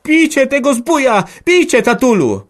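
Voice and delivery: shrill, very high, loud scream; screaming girl. Acted. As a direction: scream for father to strike. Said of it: "wrzeszczała".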